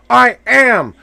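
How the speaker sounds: normal talking voice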